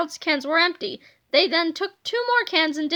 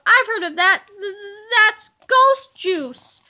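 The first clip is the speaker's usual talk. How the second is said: higher, louder, slowing into a sob; frightened cry; 5 semitones higher, 6 dB louder, slower than usual. A narrator's reading. Bug cries out to the others about ghost juice, frightened sob. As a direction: tremble out the ghost juice line. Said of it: "cried Bug".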